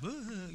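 high mock crying boo-hoo; mock boo-hoo sob. Shown as a demonstration. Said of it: "he wept, like".

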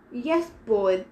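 mocking sneer, smiling cruelly; cruel smile. A narrator's reading. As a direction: belittle him with a sneer. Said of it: "said with a cruel smile".

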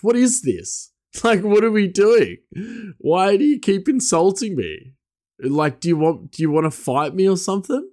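high, agitated retelling of his protest; exasperated retort. Retold or imitated. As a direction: pitch up with disbelief, asking why. Retelling confronting the bully.

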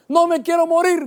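high, loud retold scream; shouted plea. Preacher retelling his plea as the car rolls, shouting. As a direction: cry out high, fearing death. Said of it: "grité".

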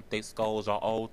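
normal talking voice

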